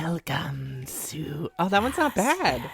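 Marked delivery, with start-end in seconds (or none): 0.0-1.5 slow hissing whisper; snake whisper. Pretend. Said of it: "We can do, like, a snake".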